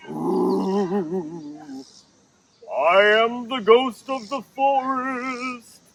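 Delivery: drawn-out ghostly moan rising at the end; haunt the woods with a long moan; spooky ghost. Acted. Jane plays second ghost.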